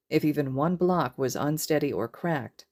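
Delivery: ordinary delivery, nothing marked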